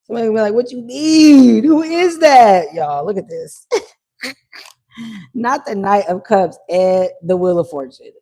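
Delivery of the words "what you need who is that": mock wail. Acts out someone reacting, wailed.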